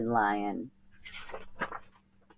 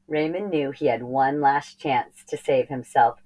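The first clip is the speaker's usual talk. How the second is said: ordinary delivery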